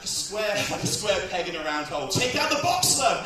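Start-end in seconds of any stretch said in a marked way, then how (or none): 2.1-3.2 high, loud heckled advice; exasperated mock shout. Comic yelling advice at the struggling woman, exasperated shout.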